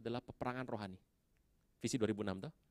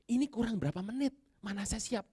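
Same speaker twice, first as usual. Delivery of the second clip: retold fretting; 9 semitones higher than usual. Unready preacher minutes before his sermon.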